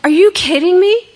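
high, loud incredulous cry; stunned, almost shrieking; shocked disbelief. Retold or imitated. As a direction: blurt it out in total disbelief. Shocked reaction to sponsor.

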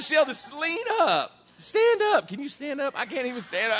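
high-pitched retold pleading; acted exasperated exchange. Teller acts out telling Sheldon to stand up, high and exasperated.

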